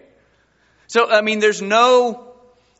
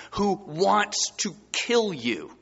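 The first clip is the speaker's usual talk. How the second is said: usual speaking voice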